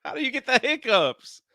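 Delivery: high amused question; amused disbelief. Real, not acted. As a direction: ask it while still laughing. Friend gets hiccups on stream.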